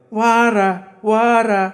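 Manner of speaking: high, drawn-out call from afar; Gokhale calling out. A narrator's reading. Schoolmaster posing behind a tree calls, high drawl. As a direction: chant it high like a stage effect. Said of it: "हा गोखले मास्तरचा आवाज".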